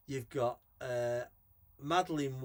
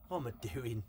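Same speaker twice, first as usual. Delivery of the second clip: ordinary delivery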